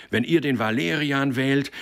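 high, loud warning; shouting faction. A narrator's reading. One faction warning the other, shouted. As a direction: cry out a threat to opponents. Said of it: "riefen die einen".

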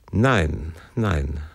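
understated stern father, voice dropping on the repeat; controlled, severe no; stern refusal. A narrator's reading. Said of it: "sagte er streng".

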